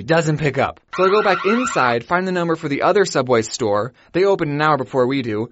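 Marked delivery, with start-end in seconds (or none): none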